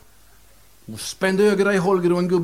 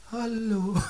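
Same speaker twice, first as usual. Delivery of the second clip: ghostly voice; 6 semitones higher, 6 dB quieter than usual. Acted. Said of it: "en röst som sa".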